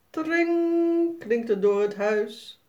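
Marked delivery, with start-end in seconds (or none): 0.1-1.2 high ringing bell imitation; doorbell ring. A narrator's reading. Deurbel klinkt. (hoog nagedaan belgeluid)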